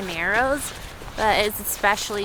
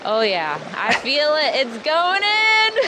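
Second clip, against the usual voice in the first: startled by icy water; gasping at the freezing cold; cold shock gasps; 2 semitones higher, 4 dB louder, much slower than usual. Real, not acted. Stepping into freezing river water.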